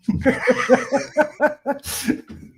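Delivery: high, long run of laughter; real extended high-pitched laugh. Real, not acted. Laughing at the nasal joke.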